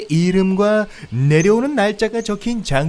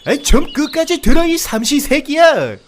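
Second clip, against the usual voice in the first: high, louder comic outburst; hammed-up irritated yell; exasperated scolding; 9 dB louder than usual. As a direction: lose patience with the interrupter. Deer snaps at interruption.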